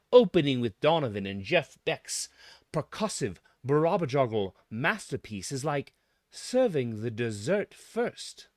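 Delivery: ordinary talk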